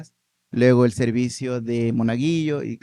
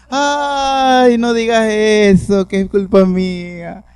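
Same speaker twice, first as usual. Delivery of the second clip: much higher, louder, slow wailing protest; distressed grandmother; 8 semitones higher, 8 dB louder, much slower than usual. Retold or imitated. Grandmother wailing at grandson's teasing that it's her fault. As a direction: cry out in dismay like a grandmother.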